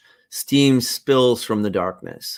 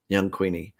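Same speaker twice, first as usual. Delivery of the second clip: ordinary talk, nothing marked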